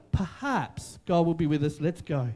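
low, hushed and trusting. Retold or imitated. Preacher voicing a believer who steps out on faith, hushed. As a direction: murmur it like a quiet step of faith. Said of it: "a new way of making decisions".

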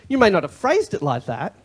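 normal talking voice

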